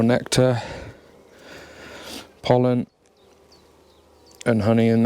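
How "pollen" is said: ordinary delivery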